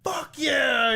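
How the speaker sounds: loud, high whoop of triumph; hammed-up victory yell; mock elated shout. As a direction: imitate someone shouting in jubilation. Acts out character's elated reaction.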